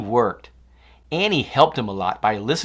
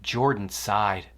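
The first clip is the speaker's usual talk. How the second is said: plain reading voice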